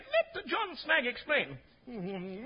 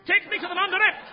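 heroic command; 8 semitones higher, 5 dB louder than usual. Acted.

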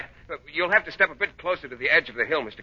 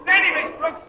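high, drawn-out shout downhill; urgent high-pitched calling; shouting directions; 5 dB louder, slower than usual. Acted. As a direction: holler warnings to a runaway skier. Shouting directions to runaway skier.

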